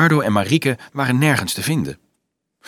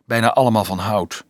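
usual speaking voice

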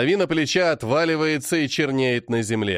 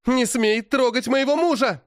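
furious shout; 11 semitones higher than usual. A narrator's reading. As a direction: defend her husband from her father. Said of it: "Вспыхивает яростно её взор". (яростный женский крик)

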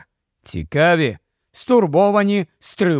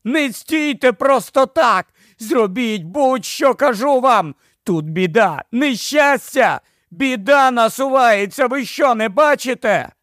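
yelling with his last strength; 7 semitones higher than usual. A narrator's reading. Ed yelling over traffic and crowd noise. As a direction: plead at full voice over the noise. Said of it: "Лойс заволав із останніх сил".

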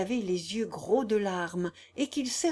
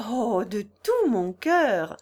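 slow, louder and heartfelt, in her own voice; heartfelt Consuelo; 6 dB louder, slower than usual. A narrator's reading. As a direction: give it gladly, drawn out. Consuelo willigt herzlich ein.

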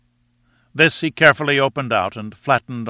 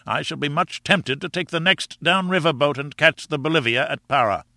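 higher-pitched complaining professor; bitter professor; 4 semitones higher than usual. Acted. Professor threatens to quit the expedition, higher and indignant. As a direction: air a grievance in a raised, indignant pitch.

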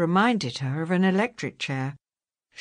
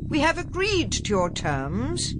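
higher, projected call; calling across room; 7 semitones higher than usual. A narrator's reading. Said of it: "he called across the tracking room".

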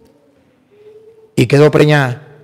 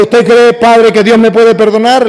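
pleading; 6 semitones higher, 4 dB louder than usual. Retold or imitated. Madman pleading, voiced by priest.

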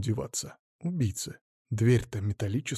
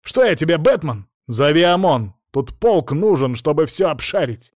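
yelling; 5 semitones higher, 6 dB louder than usual. A narrator's reading. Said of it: "заорал он".

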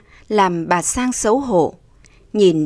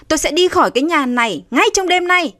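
high, fast declaration; defiant anger; 8 semitones higher, faster than usual. A narrator's reading. Slapped daughter vowing to leave home, defiant. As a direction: throw the threat back at him. Daughter storms out after slap.

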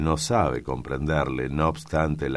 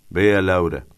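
loud, higher-pitched order; calling out; 4 semitones higher, 8 dB louder than usual. A narrator's reading. Master addressing his one-eyed cook, calling out. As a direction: call to the cook with authority.

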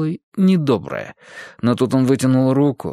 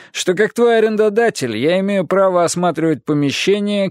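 brisk, raised, ingratiating landlord; lightly voiced pushy landlord; smooth landlord; 6 semitones higher than usual. A narrator's reading. Landlord Dyle presses. (слащавый напористый домовладелец)

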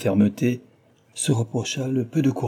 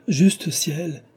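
exclaiming; 7 semitones higher than usual. A narrator's reading. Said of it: "s'écria-t-il en se levant".

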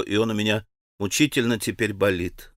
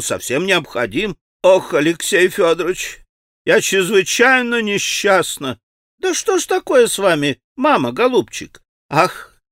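a lightly voiced anxious society lady, raised; fretful lady; 6 semitones higher, 4 dB louder than usual. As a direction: wring your hands as a fretting mother. Khokhlakova voiced, fretful lament.

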